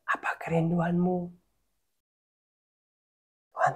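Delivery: hushed, low, half-whispered, with long pauses; soft intimate prayer. Shown as a demonstration. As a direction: ask God gently, almost whispering. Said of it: "kamu hanya ngomong lirih sama Tuhan".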